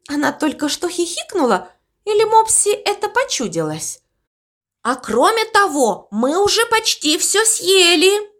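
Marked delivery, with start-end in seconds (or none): 4.8-8.3 high-pitched call down. A narrator's reading. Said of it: "крикнул Галыш".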